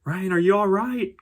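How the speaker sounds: high, loud call across the mountainside; worried yell from afar. Retold or imitated. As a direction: shout out to a friend out of sight. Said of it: "yelling at me".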